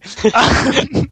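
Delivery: high nervous giggle; nervous laugh. Real, not acted. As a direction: laugh out the nerves.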